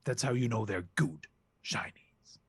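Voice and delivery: conspiratorial whisper. Acted. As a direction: whisper it like a secret. Goose king whispers secret.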